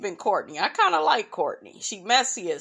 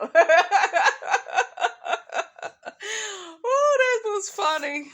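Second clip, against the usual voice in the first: high squealing laugh, then the words still laughing; can't stop laughing at the memory; laughing hard; 10 semitones higher than usual. Real, not acted. Recalling coworkers' teasing, high squealing laughter.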